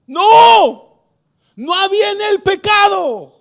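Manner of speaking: high, loud preaching denial; fervent shout. Real, not acted. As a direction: hammer the no home.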